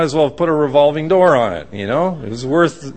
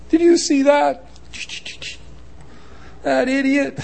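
high-pitched mock outrage; indignant driver; 9 semitones higher, 5 dB quieter than usual. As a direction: fume at another driver in a raised pitch. Irate driver, contrasted with calm one.

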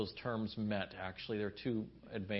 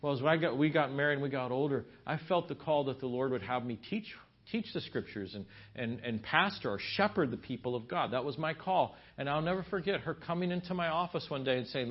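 normal talking voice

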